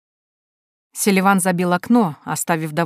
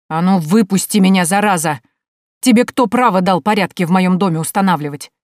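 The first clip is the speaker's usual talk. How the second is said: angry demand; 6 dB louder than usual. Acted. Locked-in uncle demands to be released.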